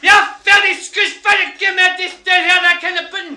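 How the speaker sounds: high, screaming Scottish rant; wild Scotsman. Retold or imitated. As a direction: rave at full volume in garbled Scottish. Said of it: "this Scotsman goes wild".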